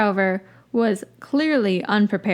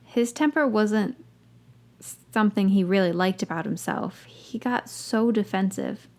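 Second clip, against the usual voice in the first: ordinary delivery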